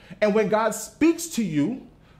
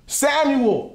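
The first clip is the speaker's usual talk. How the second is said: calling voice; 7 semitones higher than usual. Acted. God calling Samuel again.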